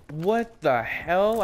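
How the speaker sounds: acted outrage, slow and high; retold shocked disbelief. Patient told he needs a screw in his leg, acting out shock. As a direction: stretch it out in mock-horrified disbelief. Retells shock at surgery news.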